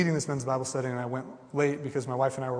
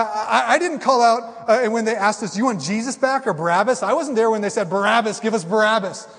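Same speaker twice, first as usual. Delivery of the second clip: higher, louder, insistent denial; defensive protest; 6 semitones higher, 7 dB louder than usual. Retold or imitated. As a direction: protest your innocence, rising to a shout. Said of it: "I'd be like".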